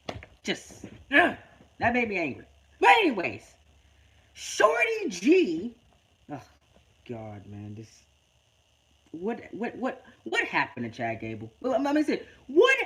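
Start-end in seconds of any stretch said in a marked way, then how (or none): none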